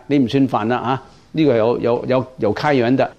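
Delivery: ordinary talk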